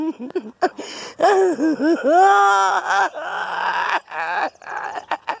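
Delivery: long wailing half laugh, half cry; shriek-laugh in panic; laughing scream while being chased. Real, not acted. Chased by birds.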